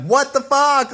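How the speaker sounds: stunned disbelief. Retold or imitated. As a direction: shriek it in happy disbelief. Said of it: "I'm like".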